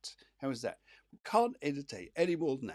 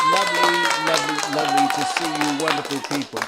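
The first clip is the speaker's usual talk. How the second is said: excited gush; 2 semitones higher, 10 dB louder, faster than usual. Real, not acted. Host gushing greeting to viewers.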